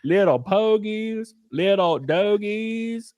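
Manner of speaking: high, slow mock Southern accent put on by an Irishman; bad Southern accent. Mocking Liam Neeson's Southern accent in the movie, slow hammed-up Southern accent. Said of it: "he's trying to have a Southern accent".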